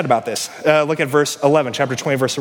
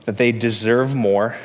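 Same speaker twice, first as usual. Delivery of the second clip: ordinary talk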